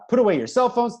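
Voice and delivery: sharp classroom command. Shown as a demonstration. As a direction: order the class like a strict teacher. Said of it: "That's a command".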